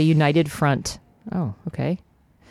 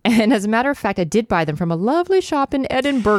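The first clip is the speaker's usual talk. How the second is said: posh mom voice; 7 semitones higher than usual. Acted. Mom voiced in read scene.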